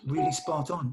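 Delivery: ordinary talk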